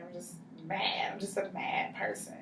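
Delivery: breathy whispered confession. Acted. Client repeats her anger to counselor in a whisper. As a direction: whisper it almost to yourself.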